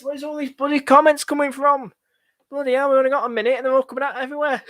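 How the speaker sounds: loud, high, overwhelmed complaint; exasperated panic. Real, not acted. Streamer flooded with last-minute comments, exasperated panic. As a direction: rush it out high and loud, flustered.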